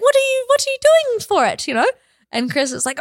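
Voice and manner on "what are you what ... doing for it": high-pitched mock mum questioning; imitating mum. Mum asking Chris about his birthday, high imitated mum voice. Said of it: "she's like".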